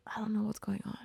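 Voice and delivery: barely audible whisper; whispered aside. Retold or imitated. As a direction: whisper it as if at a loss. Retold stunned aside, whispered.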